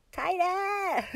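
high, loud, playful squeal of a word; playful demand. Acted. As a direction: call for a bigger squeeze, high and drawn out. Playfully demands a tighter hug.

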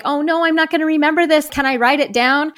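a touch of panic, rushing the words; retold mild alarm. Retold panic about forgetting.

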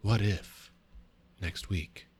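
halting Kirk voice dropping to a whisper, with long dramatic pauses; a hushed, broken-up Captain Kirk; terrible first-try Kirk, trailing into breathy whispers; Captain Kirk impression. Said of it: "this is your Captain Kirk".

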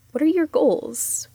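ordinary talk, nothing marked

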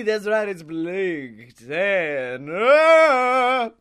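booming ringmaster with big drawn-out names; hyped show announcer. Acted. Introducing the greatest show on the planet, hammed-up announcer. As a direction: open the show like a carnival barker.

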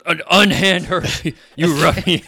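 loud heroic challenge; booming gallant hero; hammy stage actor. Acted. Heroic ranger confronts thug.

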